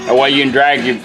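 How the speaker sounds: normal talking voice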